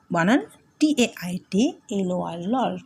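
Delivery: ordinary talk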